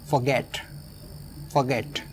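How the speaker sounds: "forget" with the weight on "get"; short first syllable, stressed "get" in "forget"; stress on last syllable. Shown as a demonstration. Teacher models "forget" for learners, stress on the last syllable. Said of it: "last में stress होगा".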